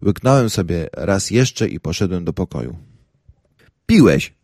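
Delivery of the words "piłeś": reproachful. A narrator's reading. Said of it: "powiedziała z wyrzutem Hanka".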